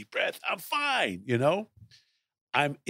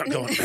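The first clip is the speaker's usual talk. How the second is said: nervous throat clearing; 13 semitones higher than usual. Retold or imitated. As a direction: clear the throat like a worried singer.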